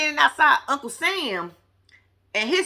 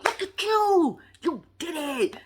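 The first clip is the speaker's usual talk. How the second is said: a light imitation of Mel hyping him up, higher; 6 semitones higher, slower than usual. Mel hyping up Martell outside, lightly mimicked excited cheer. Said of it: "And she hyping him up".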